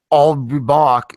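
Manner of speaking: slow, loud "I'll be back" with a hint of Austrian accent. Quoting the original Terminator line to his friends, slow Austrian accent. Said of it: "in a slow Austrian accent".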